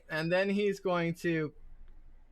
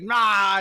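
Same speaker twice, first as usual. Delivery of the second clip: raised, loud mock roar; acted creature cry; 5 semitones higher, 8 dB louder than usual. Game master voices gargoyle biting itself, loud mock roar. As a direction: let out a short loud monster cry. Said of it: "he was like".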